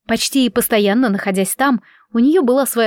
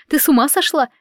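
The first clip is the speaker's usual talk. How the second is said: higher-pitched, frightened question; alarmed; 6 semitones higher than usual. A narrator's reading. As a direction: ask it in startled disbelief. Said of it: "по-настоящему испугалась мама".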